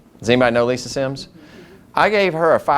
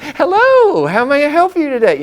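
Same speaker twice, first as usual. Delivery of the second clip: much higher, louder, exaggerated sing-song receptionist; silly chipper phone voice; 13 semitones higher, 4 dB louder than usual. Shown as a demonstration. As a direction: ham up an overly cheerful phone answer. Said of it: "I'm gonna be kinda silly".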